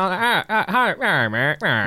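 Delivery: old-time gangster warm-up grunts, lightly voiced; 1920s mobster voice. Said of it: "I have an amazing nineteen twenties mobster voice".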